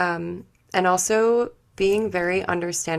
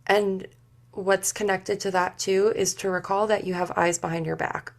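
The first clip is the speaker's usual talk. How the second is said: ordinary talk, nothing marked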